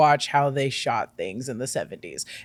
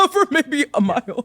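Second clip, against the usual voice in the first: laughing; 5 semitones higher than usual. Shown as a demonstration. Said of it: "laughing repeat".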